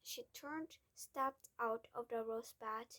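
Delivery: ordinary delivery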